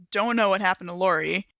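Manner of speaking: usual speaking voice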